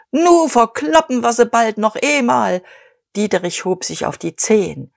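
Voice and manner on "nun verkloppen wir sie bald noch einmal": higher, raised crowing shout; screeching. A narrator's reading. Kühnchen leaping up behind the committee table, screeching. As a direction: crow it out waving a cigar.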